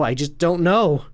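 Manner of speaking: I just don't know cried out loud and high; anguished cry. Acted. Husband cries his doubt to his wife, loud and high. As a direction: break out with it. Repeated line, loud take.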